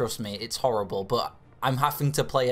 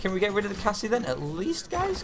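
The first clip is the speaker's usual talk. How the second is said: high, urgent calls to the team; rising pitch, begging them to act; urgent pleading; 8 semitones higher than usual. Real, not acted. Gamer begging teammates to hit the target, urgent and high.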